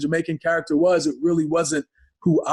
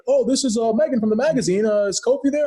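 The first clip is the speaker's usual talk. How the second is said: lightly voiced caller, pitched higher; a hint of a chirpy phone caller; magazine caller; 7 semitones higher than usual. Magazine writer phoning for Kofi, in a lightly imitated higher voice. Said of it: "magazine people would call up".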